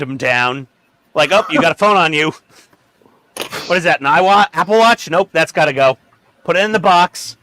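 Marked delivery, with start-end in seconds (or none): 1.3-7.4 brisk, louder, higher bossy frisking voice; mock security guard. Host mimicking a guard searching the neighbor's kid, hammed-up. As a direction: play a guard wanding down a visitor.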